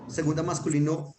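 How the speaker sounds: ordinary talk, nothing marked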